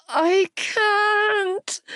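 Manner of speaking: high, strained cry of pain. Acted. She cries out in labour as the pressure builds. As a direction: cry out that you can't go on. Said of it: "I cried out".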